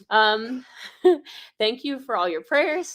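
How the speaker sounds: tearful happy laugh. Acted. Emotional thanks, laughing.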